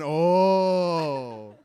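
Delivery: loud drawn-out swooning oh; husband mocking the swoon his wife never gives him. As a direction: overdo the dreamy sigh. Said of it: "Not one time has she ever gone".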